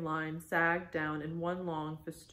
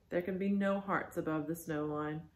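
ordinary delivery, nothing marked

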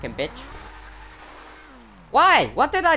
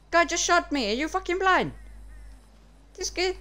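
usual speaking voice

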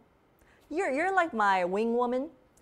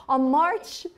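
second stab at a British accent; louder try at British vowels; playful, amateur British accent; British accent attempt; 4 semitones higher, 3 dB louder than usual. Shown as a demonstration. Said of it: "how to do the British accent".

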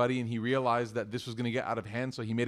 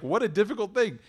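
pushing back; 8 semitones higher, 4 dB louder than usual. Retold or imitated. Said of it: "She pushes back".

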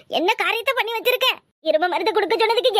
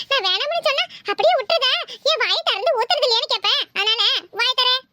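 put-on higher, teasing kid voice; bratty grandson mocking grandpa's acting; cheeky boy; 5 semitones higher than usual. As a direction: needle grandpa like a naughty boy. Boy forces medicine on grandpa.

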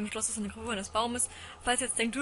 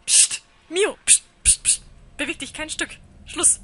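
frantic hush; 7 semitones higher, 7 dB louder than usual. Real, not acted. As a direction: shush in panic. Hushing character loudly.

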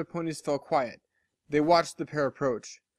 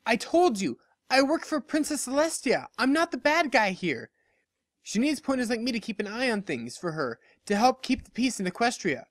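much higher, earnest defensive voice; pleading unicorn; 8 semitones higher than usual. Acted. Lyra defending herself to the hostile crowd, pleading. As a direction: beg them to listen.

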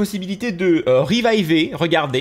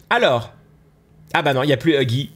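ordinary delivery

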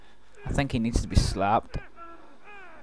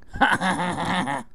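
rapid ha-ha-ha burst, trailing off; staccato laugh; 5 semitones higher than usual. Real, not acted. Player laughing after "you're wrong", staccato laugh. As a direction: laugh in quick, even pulses.

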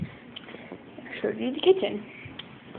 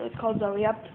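ordinary talk

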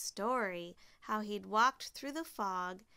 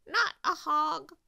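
loud, high crow voice; raucous bird character; squawking crow; 6 semitones higher, 8 dB louder than usual. A narrator's reading. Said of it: "squawked the crow".

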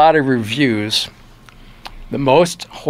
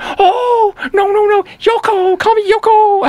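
higher, louder imitation of a Japanese accent; 4 dB louder than usual. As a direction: mimic her Japanese accent, insisting on first names. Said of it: "sorry to do the accent thing".

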